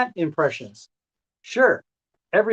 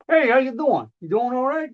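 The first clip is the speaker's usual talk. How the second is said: cheery tree voice; 9 semitones higher, faster than usual. Acted. Tree character greeting another.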